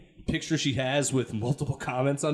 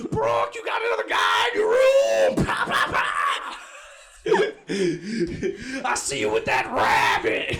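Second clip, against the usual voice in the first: Yosemite Sam; 4 semitones lower than usual. Retold or imitated. Imitating Brooke's dad as Yosemite Sam yelling. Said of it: "my dad looks like Yosemite Sam".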